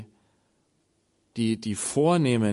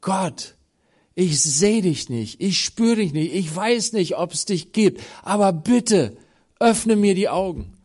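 loud, high pleading prayer; crying out to God; 5 semitones higher, 4 dB louder, slower than usual. Acted. A seeker cries out to God to open his eyes, loud and pleading. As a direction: beg God to open your eyes, full voice. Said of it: "dann fang an zu schreien".

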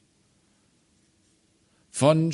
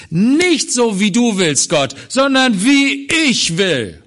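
high, loud, defiant cry against God; rebellious spirit; 9 semitones higher, 7 dB louder than usual. Acted. Preacher voicing the rebellious spirit defying God, loud defiant shout. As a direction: shout it at God as the stubborn heart. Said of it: "unter der Herrschaft des rebellischen Geistes".

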